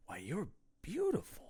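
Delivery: hushed, awestruck movie-line imitation; mock smitten. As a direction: say it very softly, as if dazzled. Acts smitten characters' movie line.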